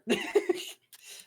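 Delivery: high burst of laughter; quick high-pitched laugh. Real, not acted. Own laugh after ending turn.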